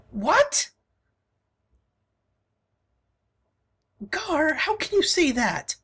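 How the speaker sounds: shocked companion. Acted. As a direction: protest in disbelief at a friend.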